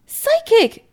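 very high, loud outburst; exclaiming. A narrator's reading. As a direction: burst out indignantly in a high voice. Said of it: "Chris exclaims".

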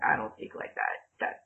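low, soft mock grumble; unimpressed native speaker. Native speaker judging an accent attempt, imitated unimpressed grumble. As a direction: imitate a listener dismissing it under their breath.

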